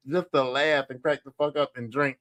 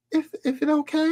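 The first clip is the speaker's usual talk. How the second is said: timid daughter; 12 semitones higher than usual. Retold or imitated. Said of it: "she's like".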